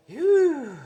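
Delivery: high, loud whew of relief; exhausted whew; breathy exhale. Real, not acted. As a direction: blow out a tired whew. Catching breath after climbing.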